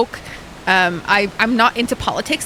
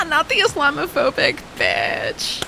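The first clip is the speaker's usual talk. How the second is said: mocking aside; 4 semitones higher, slower than usual. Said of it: "and I'm like".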